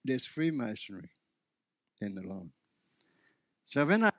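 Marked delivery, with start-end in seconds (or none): 0.0-2.5 lower, softer aside; retold whisper. Minister confiding to Anne during ministry, in a retold whisper. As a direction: confide it quietly, imitating a whisper. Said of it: "I whispered to Anne".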